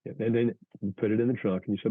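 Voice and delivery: ordinary talk, nothing marked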